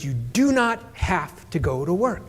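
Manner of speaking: measured, word-by-word dad, retold; slow, deliberate insistence. Dad lays out the deal to daughter, deliberate. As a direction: spell it out slowly.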